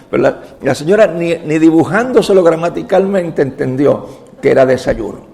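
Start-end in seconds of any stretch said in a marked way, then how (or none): none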